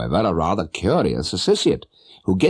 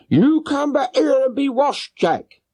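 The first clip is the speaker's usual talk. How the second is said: high, mock shout of a red-faced woman calling her boy; shouting mother; slower than usual. Mother scolding her little son, high shouting woman's voice. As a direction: call the runaway child back with a high scolding woman's voice. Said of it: "she shouted".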